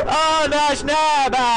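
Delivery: loud half-joking grief; distraught loud cry. Real, not acted. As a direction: cry out at the loss. Distraught at losing companion.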